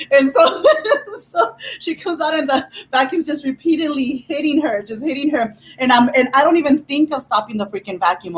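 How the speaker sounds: ordinary talk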